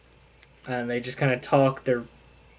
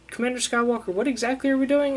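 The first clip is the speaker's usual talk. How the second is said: higher, quick mock question; curious Sarah; 10 semitones higher, faster than usual. Sarah asking Luke the plan, higher mock curious. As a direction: pitch it up, eager and quick. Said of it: "so Sarah's like".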